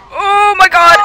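startled outburst at a sudden attack; alarmed. Real, not acted. Boss starts attacking in game.

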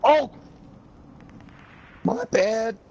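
ordinary delivery

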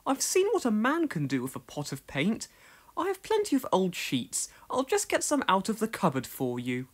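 put-on high, fussy old lady voice; a hint of a fussy spinster; prim elderly lady. A narrator's reading. Miss Hubbard voiced.

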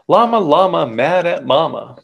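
ordinary delivery, nothing marked

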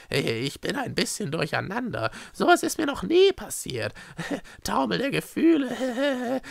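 high voice with embarrassed giggles; giddy, giggling confession; flustered Schreckse. Acted. Izanuela excuses her confusion to Echo, giddy.

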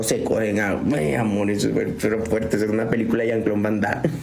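ordinary talk, nothing marked

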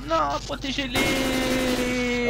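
high, wailing fake panic; hammed-up cry to save them, words dragged out; mock desperate plea. Fausse panique pour les hamburgers. (supplication paniquée surjouée)

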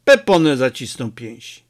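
ordinary delivery, nothing marked

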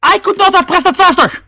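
high-pitched outraged protest; indignant yelling. Real, not acted. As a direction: yell at the game like it cheated. Protesting a missed input.

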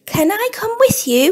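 high, eager little bird; a small bird asking hopefully. Acted. Robin asks Bear.

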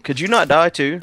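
high, loud panic; frantic alarm. Real, not acted. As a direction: yelp in mid-fight frustration. Frantic fight with skeleton.

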